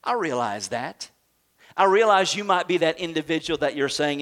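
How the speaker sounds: normal talking voice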